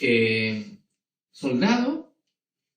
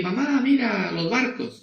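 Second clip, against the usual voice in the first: higher, mildly excited call; child calling mom; 8 semitones higher than usual. Acted. Child showing mom the boats at the beach, excited call. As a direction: point it out to mom in a raised pitch.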